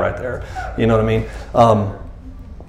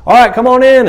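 much higher and louder, an overdone cheery invitation; mock welcome; 13 semitones higher, 9 dB louder than usual. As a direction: sing it out like a too-eager host. Master mock-inviting his servant.

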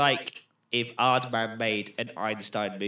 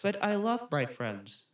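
slow, higher, softer wooden delivery; flat film boyfriend; 5 semitones higher, 4 dB quieter, slower than usual. Acted. Co-host as boyfriend answering girlfriend's ultimatum, wooden. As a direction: parody stilted movie dialogue. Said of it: "that's exactly how they talk".

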